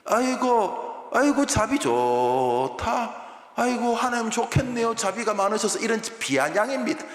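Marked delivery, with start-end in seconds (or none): 0.1-5.8 slow, higher, sing-song sneer; bitter mock congratulations; sarcastic sing-song. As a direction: croon the praise to mock it. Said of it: "이 구절은 비아냥입니다".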